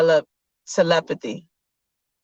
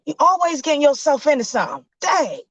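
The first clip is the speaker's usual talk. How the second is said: exasperated dad; 9 semitones higher, faster than usual. Acted. Dad scolding son.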